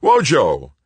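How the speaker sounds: loud, high alarmed call; yell. A narrator's reading. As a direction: yell out to a fallen friend. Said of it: "Paul yelled".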